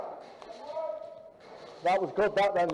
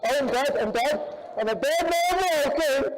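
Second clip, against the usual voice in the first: loud dramatic lament; 11 semitones higher, 5 dB louder than usual. Real, not acted. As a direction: announce your own death loudly. Shot, announcing death.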